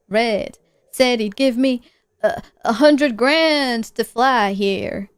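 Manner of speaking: groggy, halting man in a put-on accent; hammy injured-pilot voice; wounded pilot. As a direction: drag the words out, groping for them.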